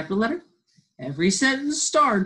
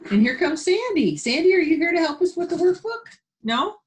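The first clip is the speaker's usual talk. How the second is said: much higher, louder cheerful call; cheerful high voice greeting Sandy; high, delighted greeting; 12 semitones higher, 5 dB louder than usual. Real, not acted. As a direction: greet a small helper with delight. Teacher greeting Sandy the helper.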